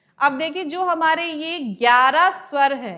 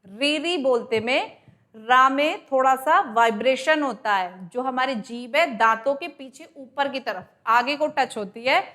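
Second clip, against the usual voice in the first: ordinary talk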